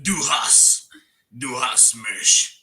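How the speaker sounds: loud, slow mock-German grunt. Shown as a demonstration.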